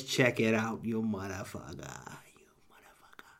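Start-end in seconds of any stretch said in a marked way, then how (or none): 0.8-2.2 mobster insult. Acted. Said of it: "If you like that kind of voice". 2.4-3.3 breathy mob whisper; whispered mobster insult. Acted. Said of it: "If you like that kind of voice".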